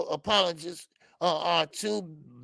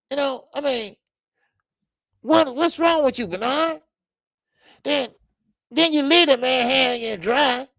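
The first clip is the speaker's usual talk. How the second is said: ordinary delivery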